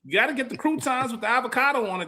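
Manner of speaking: mock voice. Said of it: "that's how you sounded".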